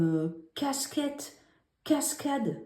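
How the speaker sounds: casquette drawn out slowly with a clear hard K at the start; sounding out the K in casquette and cascade. Shown as a demonstration. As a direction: say casquette slowly, then cascade, both opening on a crisp K. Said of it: "ça va se prononcer K comme".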